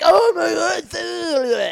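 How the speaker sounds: high excited mock shout; imitating them, shouting. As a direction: yell it like a hyped fan. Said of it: "They're like".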